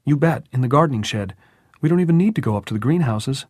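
put-on high, loud, eager boy; strangely cheerful boy. A narrator's reading. Jordan answering Tom about shovels, eagerly cheerful. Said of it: "And Jordan actually laughed".

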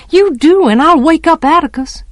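put-on high, forceful childish threat; urgent threat. A narrator's reading. Child threatening to wake Atticus, urgent and high.

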